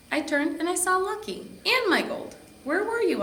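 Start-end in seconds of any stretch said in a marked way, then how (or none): 2.6-3.2 high, loud relieved yell. A narrator's reading. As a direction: shout it out in worry. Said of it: "I yelled".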